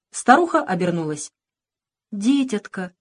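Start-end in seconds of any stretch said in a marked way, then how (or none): none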